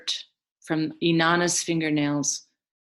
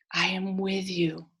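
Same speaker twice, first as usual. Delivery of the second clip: 1 whispered reassurance; 5 dB quieter than usual. Acted. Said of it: "and I am with you".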